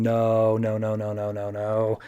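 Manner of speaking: low run of no's, fading away; retold despairing no. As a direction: let the no's sink and trail off. Gordon sees trains about to collide.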